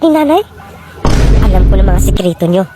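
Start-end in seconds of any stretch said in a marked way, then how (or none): 1.5-2.6 much lower, knowing aside; low inner voice. Acted. Son realizing he knows their secrets, low inner voice.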